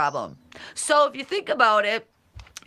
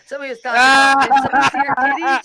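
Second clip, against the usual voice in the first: loud outburst; 4 semitones higher, 9 dB louder, much faster than usual. Real, not acted. Reacting to chat gossip.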